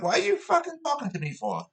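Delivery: high, fast, outraged retort to a stranger; retold outraged retort. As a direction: fire it back high and fast in disbelief. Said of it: "And I'm like".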